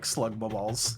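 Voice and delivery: softly muttered blurt, under the breath; hushed, barely held-back joke; said really low. Retold or imitated. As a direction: let it slip out quietly. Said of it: "I said it really lowly".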